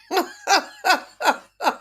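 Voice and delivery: bright burst of real laughter, high; laughing out loud at her own story. Real, not acted.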